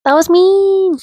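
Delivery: very high, drawn-out squeal; mock remorse. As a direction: squeak it out in playful self-reproach. Repeats her admission in mock voice.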